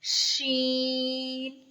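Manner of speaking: शीन held long with spread hush; long spread sheen. Shown as a demonstration. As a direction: draw शीन out, spreading the sound. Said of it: "इसमें आवाज़ को फैलाएंगे". (शीन फैलाकर लंबा)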